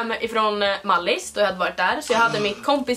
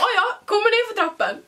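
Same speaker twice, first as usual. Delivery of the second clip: mock casual; 9 semitones higher than usual. Acting out coming down stairs.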